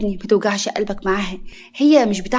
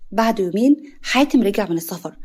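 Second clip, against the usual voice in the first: usual speaking voice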